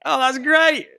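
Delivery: high, loud, over-bright retold reaction; mock delight. Retelling their own reaction to news, mock delight.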